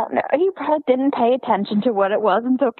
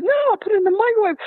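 put-on kid explaining; 10 semitones higher, much faster than usual. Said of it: "He goes".

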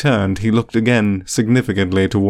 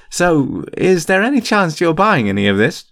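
put-on casual, higher-pitched boyish question; light, chatty inquiry with raised pitch; conversational; 8 semitones higher than usual. A narrator's reading. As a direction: ask it chattily, as if unbothered. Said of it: "Fred asked conversationally".

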